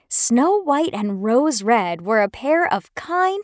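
ordinary delivery

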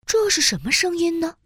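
plain reading voice

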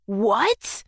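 low quiet startled what; stunned; shocked. Acted. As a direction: gasp it in disbelief. Seeing bald head.

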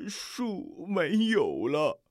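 放慢、轻声的伤心; 说到这里哽住了. A narrator's reading. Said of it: "树根说着就伤心地流下眼泪". (伤心低语)

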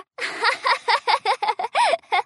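put-on bright child giggle; happy giggle. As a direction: giggle with delight. Happy ant laughter.

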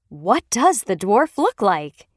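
usual speaking voice

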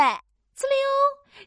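high, loud slurp noise; slurping sound effect. Acted. Child sucking up a noodle, high loud slurp.

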